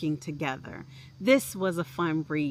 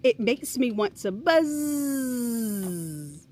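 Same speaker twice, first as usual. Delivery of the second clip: slow, higher, buzzing bee; buzzy bee voice; 5 semitones higher, much slower than usual. A narrator's reading. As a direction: stretch it out like a happy bee. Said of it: "said one of the bees".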